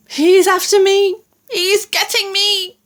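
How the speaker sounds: high, frantic cries of a giant in a nightmare; high-pitched panic; panicked scream. A narrator's reading. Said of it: "screamed the flesh lump eater, thrashing about madly".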